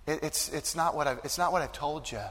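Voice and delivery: tender soft comfort; gentle reassurance. Acted. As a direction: arm around a grieving father, speak softly. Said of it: "put his arm around us and say".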